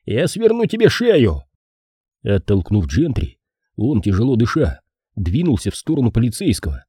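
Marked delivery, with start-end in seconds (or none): 0.1-1.4 high-pitched, furious-sounding warning; angry threat. A narrator's reading. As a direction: threaten him with the voice leaping up. Enraged detective threatens policeman. (гневная угроза высоким голосом)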